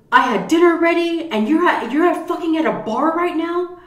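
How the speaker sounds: loud, high-pitched scolding tirade; angry wife act. Acted. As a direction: play a furious wife chewing out her husband in public. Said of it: "I was acting like his wife".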